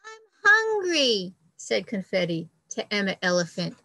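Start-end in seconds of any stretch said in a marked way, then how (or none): 0.0-1.3 high, louder whiny plea; hungry baby bird. Acted. Confetti begging the giraffe, high whiny plea. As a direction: squeak it up high like a starving chick.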